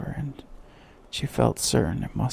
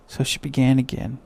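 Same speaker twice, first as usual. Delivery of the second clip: ordinary delivery, nothing marked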